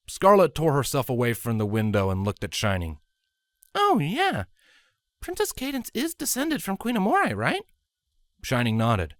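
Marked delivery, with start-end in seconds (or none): 3.7-7.6 very high, eager voice; young mare voice. A narrator's reading. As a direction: ask brightly, rising. Scarlet asks Shining.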